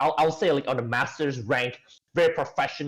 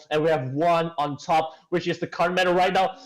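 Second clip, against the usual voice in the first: usual speaking voice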